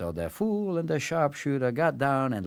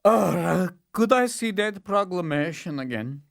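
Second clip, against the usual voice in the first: higher, nervous asking; the Tsar; 8 semitones higher than usual. Acted. Tsar asking the servant, nervous and high. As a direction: stall for time.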